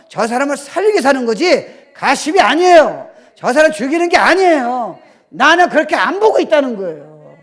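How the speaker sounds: loud, high preaching voice. Acted. Pastor preaching loudly to the church. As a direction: preach it out to the congregation.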